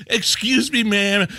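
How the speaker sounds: high, sweet mock of the stranger's polite ask. Host retelling the guy's polite ask, high and mocking. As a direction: lilt it up innocently. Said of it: "Do not approach me".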